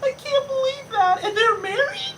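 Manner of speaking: high falsetto wail of disbelief; mock crying outrage. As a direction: whine like a scandalized prude. Acted homophobe freaking out.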